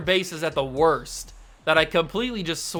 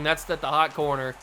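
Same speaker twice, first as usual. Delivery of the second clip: ordinary talk, nothing marked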